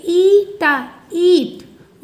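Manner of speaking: ईटा then ईट called out loud, the long ई sound held; blending the word eat. Shown as a demonstration. Teacher blending ईट for the class, loud and clear. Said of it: "तो इसे क्या पढ़ेंगे?".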